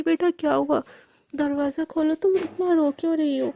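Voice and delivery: plain reading voice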